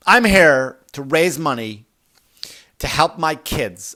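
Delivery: a wife pleading her worthy cause; self-righteous wife. Retold or imitated.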